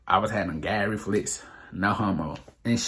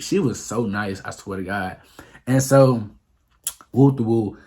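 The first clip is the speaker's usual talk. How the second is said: ordinary talk, nothing marked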